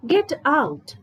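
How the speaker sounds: telling someone to leave; sharp command. Shown as a demonstration. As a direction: bark it like an angry order. Teacher reads example command.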